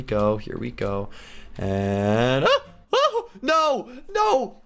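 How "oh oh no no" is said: high-pitched cries of dismay; alarmed protest. Real, not acted. Player losing momentum, high alarmed protest. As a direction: protest in a high voice as it slips away.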